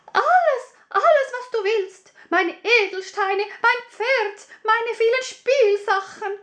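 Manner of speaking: the princess promising everything in a rush; eager princess. Acted. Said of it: "antwortete die Prinzessin". (hohe, eifrige Prinzessinnenstimme)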